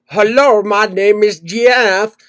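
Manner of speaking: slow, drawn-out mock Indian accent; bad fake accent. Spam caller claiming to be Jeff, mock Indian accent. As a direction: imitate a caller's terrible Indian-accented greeting. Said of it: "That's the worst fucking accent I've ever heard".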